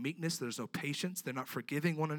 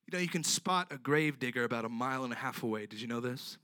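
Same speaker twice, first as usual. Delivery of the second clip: ordinary talk, nothing marked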